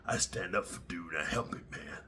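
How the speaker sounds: low, soft, lightly voiced tough guy; a hint of a macho commenter; mock commenter. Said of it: "you guys in the comments be like".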